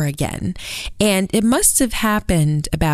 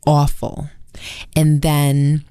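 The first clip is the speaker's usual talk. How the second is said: ordinary talk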